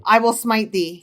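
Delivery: loud, archaic smiting threat; mock biblical prophet. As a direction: pronounce doom like an old prophet. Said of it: "I'm God".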